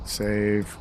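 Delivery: usual speaking voice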